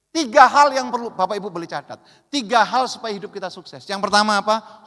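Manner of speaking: full-force sermon delivery; forceful preaching. Real, not acted. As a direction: drive the point home to the pastors.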